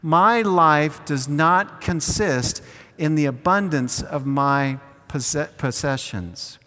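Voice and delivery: "My life does not consist" said slowly, word by word, for the congregation to follow; slow unison recital. Shown as a demonstration. Pastor leading the congregation in slow call-and-response. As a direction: lead the room in a slow, measured repeat. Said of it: "Can you say that with me?".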